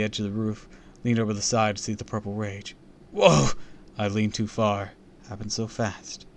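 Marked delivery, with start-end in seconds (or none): 3.1-3.5 high, loud exclamation; startled cry. A narrator's reading. Boy leans too far over the roof, startled cry. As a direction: yelp as footing goes. Loses balance on roof edge.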